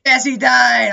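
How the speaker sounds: loud, high cheer of victory; triumphant shout. Real, not acted. Celebrating a kill.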